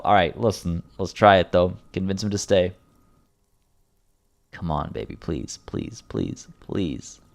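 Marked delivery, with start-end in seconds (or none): 4.5-7.2 soft, low pleading, near whisper; hushed desperate plea; begging. Acted. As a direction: beg him quietly to stay. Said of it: "Tears and begging".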